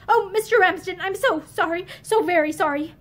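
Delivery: plain reading voice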